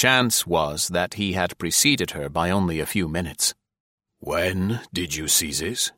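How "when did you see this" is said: slow, softer, measured question; repeated demand. Acted. General presses Marie for an answer, slowly insistent. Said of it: "repeated the general".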